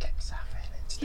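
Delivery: breathy conspiratorial whisper; whispered aside. Acted. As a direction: whisper it as a sneaky tip. Whispered aside about casting.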